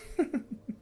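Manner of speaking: high, breathy villain laugh; patron's laugh. Acted. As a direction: let the patron laugh through the veil. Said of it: "as he laughs".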